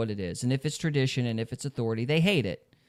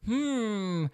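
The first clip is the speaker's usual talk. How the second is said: mocking Trump voice; 9 semitones higher, 6 dB louder than usual. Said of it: "It's like".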